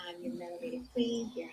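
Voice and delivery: usual speaking voice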